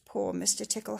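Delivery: usual speaking voice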